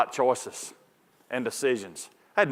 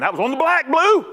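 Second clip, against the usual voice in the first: mock ballpark yell; loud, high complaint from the dugout; acted protest; 11 semitones higher, 4 dB louder than usual. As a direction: holler at the umpire, shooting up high on the last word. Acted protest called out to umpire.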